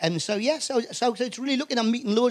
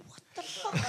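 high, whispered disbelief; stunned whisper; 12 semitones higher, 16 dB quieter than usual. Acted. Actor hearing of a Best European Actor nomination, stunned whisper. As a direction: mouth it almost silently in shock.

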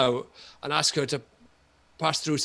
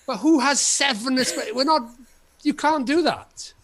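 exasperated rant; 9 semitones higher than usual. Retold or imitated. Continued angry rant.

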